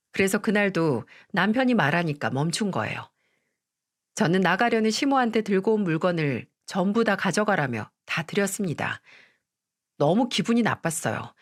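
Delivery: usual speaking voice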